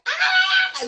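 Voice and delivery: high, loud joyful scream out the car window; retold scream of joy. Telling friends about seeing her book online, joyful shriek. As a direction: let out a joyful shriek, re-living it. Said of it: "I was like".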